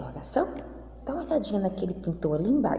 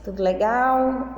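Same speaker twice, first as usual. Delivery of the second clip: cheery greeting; 7 semitones higher, 4 dB louder, much slower than usual. Real, not acted. Teacher greeting her pupils with a drawn-out cheery hello.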